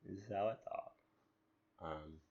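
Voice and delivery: ordinary talk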